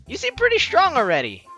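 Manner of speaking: ordinary talk, nothing marked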